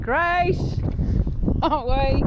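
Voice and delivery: high, loud call across a field. Real, not acted. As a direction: shout the dog's name out. Calling dog from afar.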